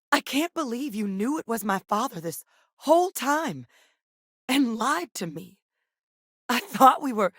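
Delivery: high-pitched, indignant reproach; hurt accusation. Acted. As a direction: confront a betrayer, breaking off at the end. Acted confrontation over a lie.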